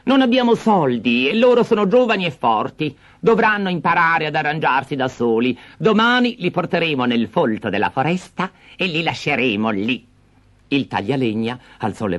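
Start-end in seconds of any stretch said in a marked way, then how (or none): 0.0-10.1 high, hard-hearted stepmother voice; cruel stepmother. Acted. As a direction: lay out the plan coldly. Stepmother plots abandonment.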